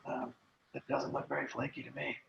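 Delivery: ordinary talk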